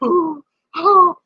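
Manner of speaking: high mock wail; high, tearful moan put on for the story; imitated crying. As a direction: act out a drunken cry. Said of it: "Now I start crying".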